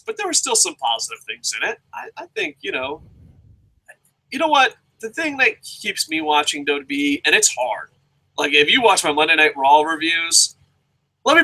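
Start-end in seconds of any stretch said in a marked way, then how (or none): none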